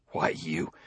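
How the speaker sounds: low, soft mutter to himself; inner thought. A narrator's reading. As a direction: bite back the words, under the breath. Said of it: "he thought".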